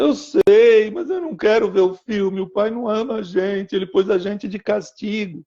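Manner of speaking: a hurt little daughter complaining through tears; whiny crying girl. Retold or imitated. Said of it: "eu ouvi minha filha chorando". (menina chorosa, voz aguda e lamentosa)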